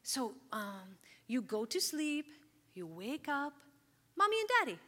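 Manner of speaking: Ethiopian-English family talk. Retold or imitated. Mom to young daughter, explaining forever family in a light accent. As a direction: talk simply, in a gentle accent, for a small child. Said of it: "we talked a little bit like this".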